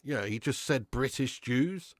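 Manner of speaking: ordinary talk, nothing marked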